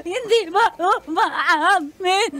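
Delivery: put-on drunk laugh-crying. Drunk friend wailing over breakup.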